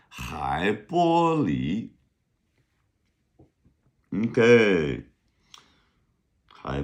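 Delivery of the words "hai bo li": Hai bo li drawn out slowly; slow model reading. Shown as a demonstration. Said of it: "literal translation".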